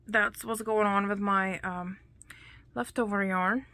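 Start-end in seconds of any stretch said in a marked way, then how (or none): none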